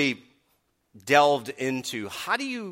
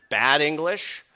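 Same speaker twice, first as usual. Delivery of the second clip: ordinary delivery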